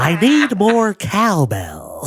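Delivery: high, slowly stretched celebrity impression; Christopher Walken. Friend joins the impression round, high drawn-out Walken. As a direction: draw out each word in an odd, swooping cadence. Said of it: "We'll all do a Christopher Walken impression".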